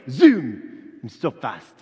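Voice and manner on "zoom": loud whooshing zoom; zoom sound effect. Acted. Angel zipping off to Zachariah, loud zoom.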